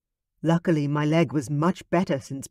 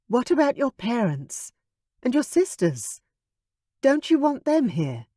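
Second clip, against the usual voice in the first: higher, lightly voiced young woman; young woman's voice; 9 semitones higher than usual. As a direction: ask it as the princess, hopeful. Veronica's higher voice.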